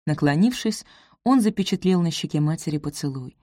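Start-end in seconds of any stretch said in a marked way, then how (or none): none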